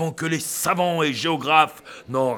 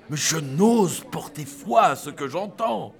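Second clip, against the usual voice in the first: ordinary delivery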